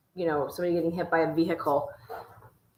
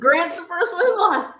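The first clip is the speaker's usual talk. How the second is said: excited, higher and louder repeat; hyped cheer; 10 semitones higher, 7 dB louder than usual. Real, not acted. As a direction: echo it like a hype man. Hyped echo of the line.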